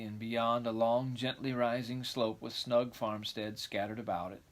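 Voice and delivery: ordinary delivery, nothing marked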